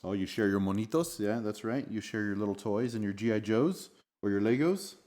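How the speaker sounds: ordinary delivery, nothing marked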